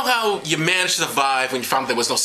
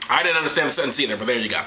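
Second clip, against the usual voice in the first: ordinary talk, nothing marked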